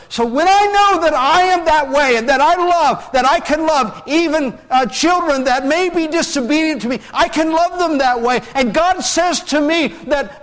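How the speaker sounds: high, loud passionate preaching; impassioned preaching. Acted. Pastor telling the church of God's love, impassioned. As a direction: build it up with full conviction.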